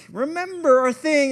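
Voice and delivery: high, loud imitation of wife; wife's warning. Wife reminding husband of his rule, warning.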